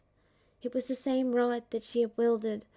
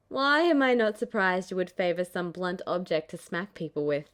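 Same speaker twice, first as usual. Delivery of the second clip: put-on lower, teasing young man; teasing Zack voice; 4 semitones lower, 4 dB louder than usual. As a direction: tease her with a grin.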